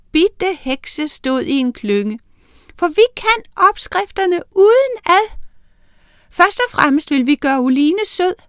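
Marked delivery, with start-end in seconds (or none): 2.8-8.4 much higher, tiny piping voices; tiny witches' voice. Acted. The little witches reassuring Miranda, high and tiny.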